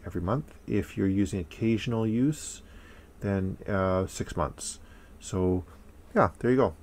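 muffled behind respirator; speaking into mask fabric; through a face mask. Real, not acted.

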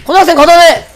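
shouting raid commands, high and loud; yelling across the room. Real, not acted. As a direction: bark orders over the battle. Raid leader calling out commands.

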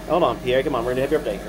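ordinary talk, nothing marked

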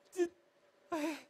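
ordinary delivery, nothing marked

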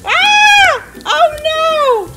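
surprised cry. Real, not acted. Mom reacts to mud.

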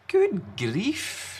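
a gentle grown-up exclaiming; Large, a loving parent figure. Acted. Large speaks to Small.

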